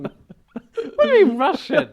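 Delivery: high, slow, loud laughing protest. Real, not acted. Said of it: "You sound Russian".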